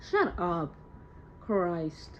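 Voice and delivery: ordinary talk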